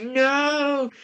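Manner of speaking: high, drawn-out mock wail; imitated cry of no. Character watching both fall off the side, mock wail. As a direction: stretch it into a hammed-up high cry.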